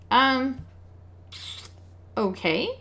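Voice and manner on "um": ordinary delivery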